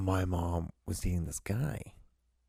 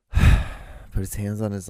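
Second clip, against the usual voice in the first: ordinary talk, nothing marked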